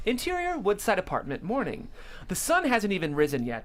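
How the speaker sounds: ordinary delivery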